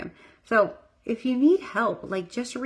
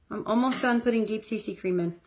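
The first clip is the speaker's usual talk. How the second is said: usual speaking voice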